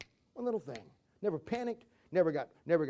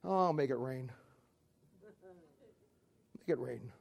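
low, soft, offhand reply; unbothered calm; 6 semitones lower, 8 dB quieter than usual. Acted. God casually answering a panicked angel, low and soft. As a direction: drop low and quiet, totally unworried.

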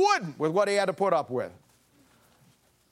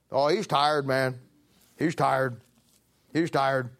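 ordinary talk, nothing marked

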